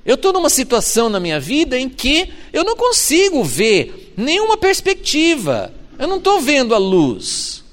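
high, pleading cry to God; desperate prayer. Pretend. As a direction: pour out a despairing prayer, pitched high. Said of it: "você chega pra Deus e fala assim". (oração desesperada, voz bem aguda)

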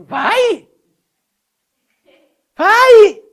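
high, loud fed-up shout; mock exasperated God. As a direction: shoo her off with a shout. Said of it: "Deus fala assim". (Deus irritado gritando vai)

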